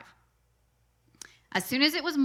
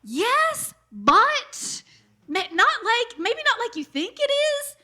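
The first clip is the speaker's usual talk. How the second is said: high, strained mock hesitation; pitched-up sheepish yes but not like that; awkward hedging yes; 12 semitones higher, 5 dB louder, slower than usual. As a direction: squeak it out, unsure how to explain. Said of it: "both of us are like".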